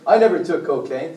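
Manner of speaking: ordinary talk, nothing marked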